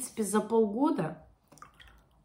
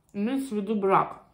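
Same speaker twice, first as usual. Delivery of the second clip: chewing while talking; speaking between bites; mouth full of lettuce. Real, not acted. Seen 1: Lettuce at mouth, bitten at 1.6-1.9, then chewing with lips closed.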